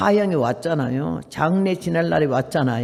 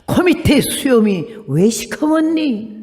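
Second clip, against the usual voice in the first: slow, louder punchline, each word drawn out; punchline reveal; 5 semitones higher, 4 dB louder, slower than usual. Shown as a demonstration. Preacher reveals the joke's meaning to the congregation, slow and emphatic. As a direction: land the joke slowly and deliberately. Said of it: "컴메디 쇼미시 컴머니라는 말은 무슨 말인가".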